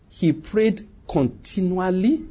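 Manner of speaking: "continually" drawn out very slowly, lifting at the end; stress on continually. Real, not acted. Preacher teaching Cornelius's habit, slow emphasis on "continually". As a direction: stretch out "continually" syllable by syllable with a rising tune. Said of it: "I want to emphasize that word".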